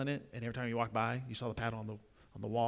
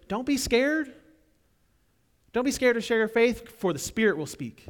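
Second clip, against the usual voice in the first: high, loud pleading call; urgent cry; 9 semitones higher, 7 dB louder than usual. Acted. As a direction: call out not to be afraid. Preacher voicing God's urging.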